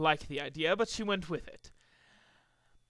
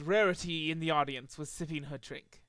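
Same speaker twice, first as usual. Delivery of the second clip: ordinary delivery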